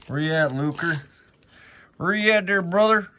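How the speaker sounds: high, loud calling for the card; excited calling out. Real, not acted. Card collector calling to his pack, loud and high. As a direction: shout for the card he wants.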